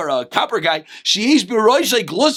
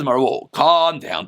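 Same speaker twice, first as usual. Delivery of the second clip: soothing plea; 8 semitones lower, much slower than usual. Acted. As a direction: ease him down gently and slowly. Rabbis soothing upset Rabbi Yochanan.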